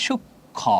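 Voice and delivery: "সূক্ষ্ম" broken softly into shuk-kho, no m; ম অনুচ্চারিত. Shown as a demonstration. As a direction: say "সূক্ষ্ম" softly as k plus kh, m left silent. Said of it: "উচ্চারণটা কি হবে?". ("সূক্ষ্ম" নরম করে শুক্-খো, ম ছাড়া)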